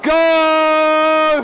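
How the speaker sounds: rising shout to a full GO; race start call. Real, not acted. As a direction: call the start, building loud and high.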